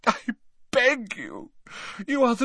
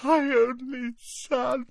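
moaning; slower than usual. A narrator's reading. Crumpled mother moaning on the floor. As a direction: whimper it. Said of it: "sobbing and moaning".

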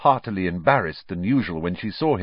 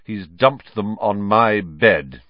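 louder, very slow grievance; long-suffering; 8 dB louder, slower than usual. A narrator's reading. Percy complaining about things on his bed, long-suffering. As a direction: stretch out the complaint. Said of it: "in a long-suffering voice".

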